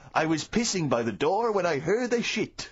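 put-on slow comic French accent. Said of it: "I'm talking in a French accent".

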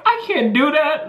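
high-pitched, incredulous refusal; squealed mock protest; retold protest. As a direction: object in amused disbelief. Retold protest to teacher's advice.

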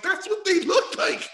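mock-innocent kid explaining; slow, high, sheepish teen voice; teen self defending. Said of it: "I'm like".

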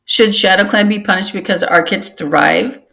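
ordinary delivery, nothing marked

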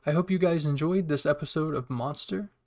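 loud, upbeat channel sign-off; punchy, projected host voice; video outro. Real, not acted. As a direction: wrap up the episode with big energy.

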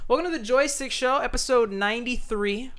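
high, upbeat show host; announcer intro. Acted.

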